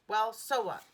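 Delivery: ordinary delivery, nothing marked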